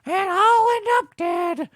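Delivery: high hammed-up cartoon goodbye; half-hearted melodrama. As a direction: bid a hammed-up tragic goodbye. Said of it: "half-hearted, attempted".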